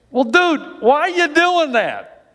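high-pitched, drawn-out disbelief; incredulous. Acted. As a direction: question a friend in high-pitched bafflement.

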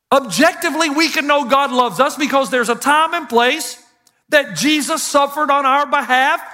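an impassioned pulpit proclamation; preaching at full force. Acted. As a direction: preach it out to the whole room. Preaching to congregation.

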